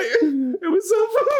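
high, drawn-out laughing squeal; cracking up. Real, not acted. Friend joking beside the priest, high drawn-out laughing delivery. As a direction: stretch it out through real laughter.

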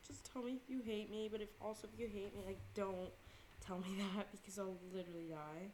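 a hint of nervous begging; quiet half-laughing plea; pleading quietly. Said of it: "So I'm like".

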